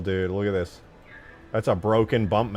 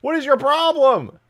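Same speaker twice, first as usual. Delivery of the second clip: loud, high-pitched scolding; exasperated yelling; 12 semitones higher, 5 dB louder than usual. Real, not acted. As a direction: tell off the chat in loud disbelief. Scolding chat for spamming.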